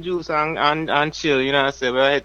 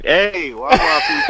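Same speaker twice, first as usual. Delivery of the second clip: hype greeting; 4 semitones higher, 8 dB louder, slower than usual. Acted. Radio host greeting on air.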